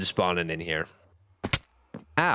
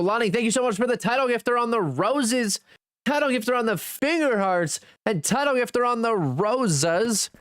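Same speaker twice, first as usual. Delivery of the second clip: high, bright shout-out; excited thanks; 11 semitones higher than usual. Real, not acted. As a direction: cheer the gifter on. Thanking gifters on stream.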